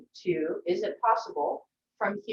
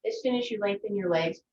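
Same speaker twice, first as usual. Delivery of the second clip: ordinary delivery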